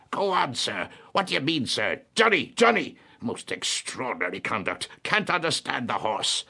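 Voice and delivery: very high, blustering imitation of an outraged gentleman; pompous indignant old gentleman. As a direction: splutter with pompous outrage, mimicking him. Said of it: "was highly indignant".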